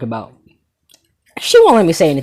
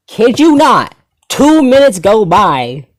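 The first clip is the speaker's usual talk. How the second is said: higher, louder, slow emphatic telling; loud dramatic emphasis; 6 semitones higher, 7 dB louder, slower than usual. Acted. Telling viewers about the classmate, loud slow dramatic emphasis. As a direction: hit it like a big reveal, slow and loud.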